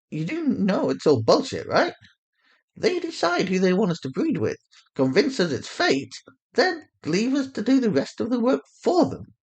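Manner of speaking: Sanaya's voice. Acted. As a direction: pitch her up and let the contempt show. Narrator voices Sanaya, bitter.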